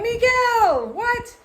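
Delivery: high, loud astonished cry of his name; surprised greeting. Retold or imitated. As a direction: call out his name in shock. Surprised to meet him again.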